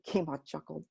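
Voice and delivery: usual speaking voice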